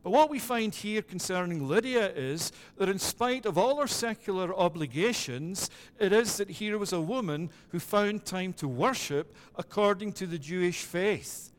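normal talking voice

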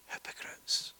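hushed, voiceless condemnation; scornful whisper. Real, not acted. Preacher judging the brothers' false comfort, scornful whisper. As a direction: drop to a whisper for the verdict.

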